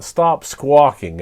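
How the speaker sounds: plain reading voice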